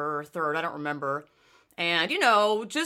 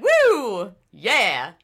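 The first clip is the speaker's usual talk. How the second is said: cheer; 2 semitones higher, 9 dB louder than usual. Real, not acted.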